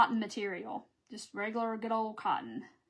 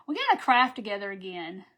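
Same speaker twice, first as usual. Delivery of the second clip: normal talking voice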